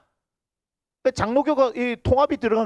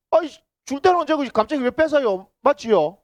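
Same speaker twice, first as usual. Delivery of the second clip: voicing Abraham's protest; 6 semitones higher than usual. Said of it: "이러면서".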